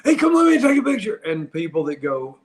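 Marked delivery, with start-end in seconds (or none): 0.0-1.2 eager fan. Retold or imitated. Fan asking for a picture.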